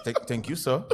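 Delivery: low, soft, polite thanks; suddenly proper and respectful, voice dropped; real clear voice. Retold or imitated. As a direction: play the meek, courteous driver. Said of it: "your voice got real clear then".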